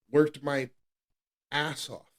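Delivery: ordinary delivery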